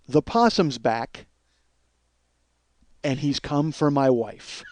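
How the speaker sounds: slow, drawn-out mock dread; ominous reveal. Husband imagining a possum out for revenge, mock ominous. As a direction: let each word land like a horror-film twist.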